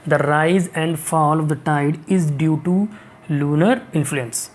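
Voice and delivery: very slow, deliberate read; corrected sentence read slowly. Shown as a demonstration. Teacher reads the corrected sentence slowly to students. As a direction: read the fixed sentence word by word. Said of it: "is, ठीक है".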